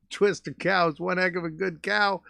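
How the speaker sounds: normal talking voice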